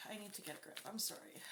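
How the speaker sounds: soft, choked-back apology; overcome, voice dropping away; emotional. Real, not acted. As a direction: struggle to get a grip, quietly. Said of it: "it gets really emotional".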